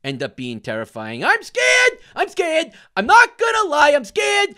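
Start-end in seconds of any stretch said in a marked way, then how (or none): 1.2-4.6 high, loud frightened yell; scared yelling. Real, not acted. Player spooked by the game, yelling scared. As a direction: shout your fear out. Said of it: "if I stop yelling".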